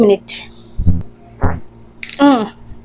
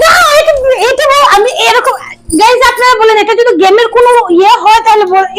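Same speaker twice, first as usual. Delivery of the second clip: indignant outcry; 7 semitones higher than usual. Real, not acted. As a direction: complain to viewers in outrage. Indignant complaint after slap.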